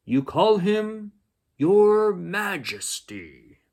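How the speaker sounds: high, slow, stately announcement; grand director voice. Acted. Museum director telling the pals the royal title, slow and grand. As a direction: say Majesty slowly and grandly.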